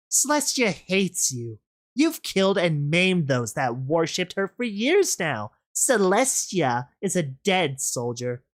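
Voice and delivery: cruel villain. Acted. Rainbow Dash taunting the rebel over Celestia, cruel. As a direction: sneer at a fool who still hopes. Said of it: "The cruel voice".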